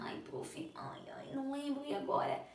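soft, whiny-worried pupil imitation; mock hesitant pupil asking the teacher for help; doubtful pupil. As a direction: act a student stuck and unsure, half under the breath. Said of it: "quando surgir essa duvidazinha".

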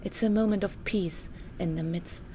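ordinary delivery

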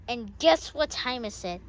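ordinary talk

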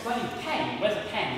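ordinary delivery

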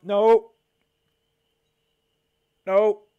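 high, loud nope; alarmed shout. Real, not acted. Vlogger yelling at the video, alarmed shout. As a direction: yell it to stop them. Reacting to a risky clip.

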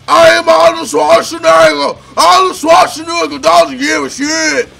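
shouted, badly faked Austrian accent; Arnold Schwarzenegger. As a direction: bellow in a terrible Austrian accent, pitched high. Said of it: "I'm terrible at accents".

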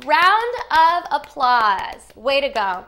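loud, stretched-out cheer; loud, drawn-out congratulation; excited praise. Pretend. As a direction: announce it big, high and slow. Cheers children after the song.

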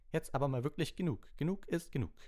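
loud, high-pitched angry professor; stern scolding. A narrator's reading. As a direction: put your foot down, loud and high.

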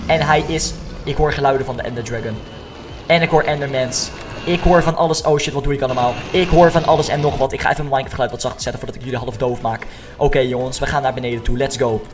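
ordinary talk

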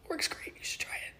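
soft breathy whisper. Real, not acted. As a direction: whisper it like a secret.

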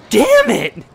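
real exasperated yell, pitched high; frustrated shout. Real, not acted. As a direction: shout it at your own mistake. Player forgot to lower speed.

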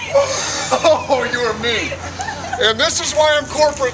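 loud, high laughing complaint. Real, not acted. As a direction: laugh through the shock. Laughs after the ice bucket.

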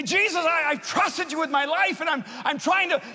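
high, loud pleading cry to Jesus; anguished prayer. Retold or imitated. Broken young man crying out to Jesus, anguished plea. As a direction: pour out the hurt, breaking under it.

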